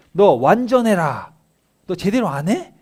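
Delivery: high, loud, slow divine rebuke; stern God scolding. Acted. God scolding Abraham for not walking right, loud stern rebuke. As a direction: thunder it down as an angry God.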